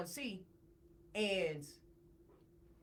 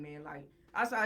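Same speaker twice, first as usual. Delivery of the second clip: ordinary talk, nothing marked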